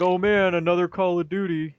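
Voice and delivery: whiny gamer groan; complaining. Retold or imitated. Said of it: "complain, like".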